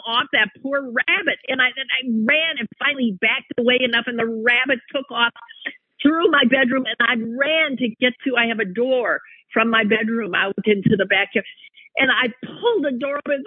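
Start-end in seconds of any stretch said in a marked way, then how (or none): none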